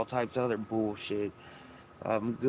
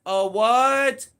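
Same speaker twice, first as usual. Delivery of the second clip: loud, higher call to the next room; 11 semitones higher, 8 dB louder than usual. Real, not acted. Man calling to his girlfriend in another room, loud. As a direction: shout the question across the house.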